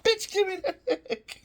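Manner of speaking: high, loud mock yell; hammed-up squealed order; mock demand. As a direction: playfully shriek it, about to laugh. Plays kid demanding the egg.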